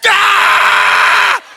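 high, very loud mock scream; startled scream. Preacher acts out dad hit by toddler, startled scream. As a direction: scream like you've just been whacked.

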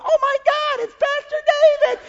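very high falsetto mock excitement; gushing onlookers. Passengers spot their pastor on the plane, mock-amazed falsetto. Imitates passengers recognising him.

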